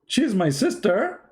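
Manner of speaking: high, slow stunned question; shocked disbelief. Retold or imitated. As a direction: draw it out in disbelief. First shocked take.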